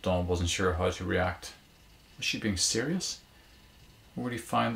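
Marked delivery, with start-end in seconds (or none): none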